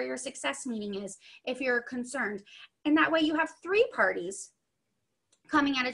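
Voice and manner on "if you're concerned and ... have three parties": normal talking voice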